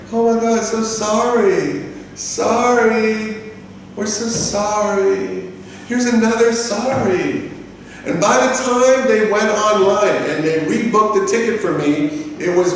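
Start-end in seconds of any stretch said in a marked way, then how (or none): 0.1-7.5 high, slow, drawn-out apologizing; mock apology. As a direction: coo exaggerated fake apologies. Mocking staff's repeated apologies.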